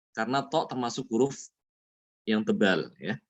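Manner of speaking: usual speaking voice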